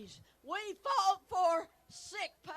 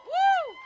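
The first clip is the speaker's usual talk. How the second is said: excited whoop; 12 semitones higher, 7 dB louder than usual. Real, not acted. Cheering the student's answer.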